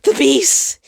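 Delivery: sudden high, loud frightened gasp; gasped in fear. Acted. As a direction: blurt it out in sudden terror. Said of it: "he gasped".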